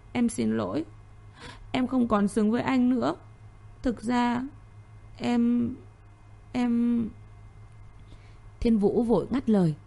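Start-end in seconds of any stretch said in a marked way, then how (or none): 0.1-7.1 a touch of tears, softer at first; lightly voiced girl apologising through tears; tearful. A narrator's reading. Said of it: "Tuệ Lâm nước mắt như mưa". (giọng nghẹn nước mắt nhẹ)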